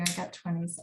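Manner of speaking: ordinary talk, nothing marked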